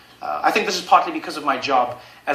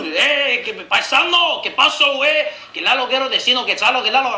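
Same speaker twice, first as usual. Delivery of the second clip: higher, babbling mock Spanish; imitated Spanish speaker; 6 semitones higher than usual. Strangers speaking Spanish out of the blue, imitated Spanish speaker. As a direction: accost someone with high Spanish chatter. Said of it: "just speaking to me in Spanish randomly".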